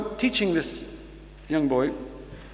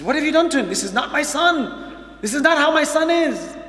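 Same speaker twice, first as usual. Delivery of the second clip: much higher and louder, amazed and bursting; excited father; 10 semitones higher, 3 dB louder than usual. Retold or imitated. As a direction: retell the thrilled dad almost shouting in disbelief. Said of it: "All excited".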